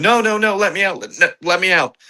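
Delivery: high, panicked mock pleas to be let out; a captive woman begging to be let out, high and panicked; retold panicked pleading. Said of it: "She's screaming".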